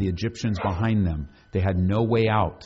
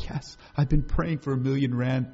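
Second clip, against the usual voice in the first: eager listener; 5 semitones higher than usual. Pretend. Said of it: "And you go".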